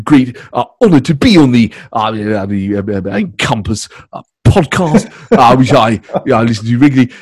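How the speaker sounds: Trump impression with a grimace; Trump voice demo. Shown as a demonstration. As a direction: pull a Trump face and boast. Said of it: "I do that when I'm recording it".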